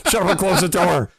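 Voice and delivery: high snapping wife voice; hammed-up high wife telling him off; mock wife. Wife snapping back at husband, mock high voice. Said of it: "she's like".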